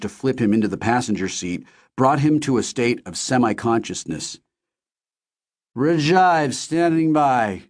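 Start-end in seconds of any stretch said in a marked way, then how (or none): 5.8-7.6 slow, slurred, drowsy words; drunken slur. Acted. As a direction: slur it like he's heavily drugged. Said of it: "he said drunkenly, slurring his words".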